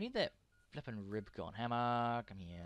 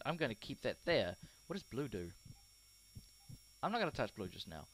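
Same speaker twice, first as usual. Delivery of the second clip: usual speaking voice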